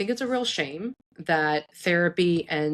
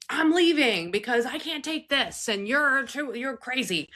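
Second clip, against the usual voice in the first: higher, exasperated snap of a fed-up parent; mock angry outburst; 8 semitones higher than usual. Fed-up parent snapping back at a screaming kid, higher and hammed-up. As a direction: play the parent losing it. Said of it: "if your kid is screaming at you".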